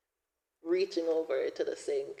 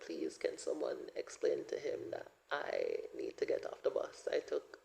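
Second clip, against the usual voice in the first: hushed desperate plea; 10 semitones lower, 5 dB quieter than usual. Retold or imitated. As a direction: plead quietly, almost under the breath. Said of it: "I'm just like".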